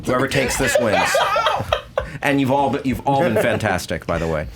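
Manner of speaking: usual speaking voice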